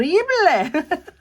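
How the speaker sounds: a quick laugh at the bad lighting; short self-conscious laugh; embarrassed laugh. Real, not acted.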